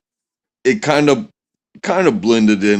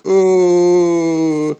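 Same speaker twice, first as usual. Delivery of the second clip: long, high, steady "ooh"; mesmerized "ooh"; 8 semitones higher than usual. Retold or imitated. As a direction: hold one high note, mindlessly carried away. Mimics being absorbed in snipping hair.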